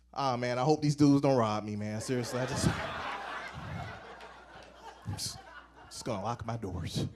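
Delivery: very low, nervous mumble; uneasy muttering. Acted. Driver to himself, hoping not to be robbed, uneasy low mutter. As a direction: sink into a low, worried mumble.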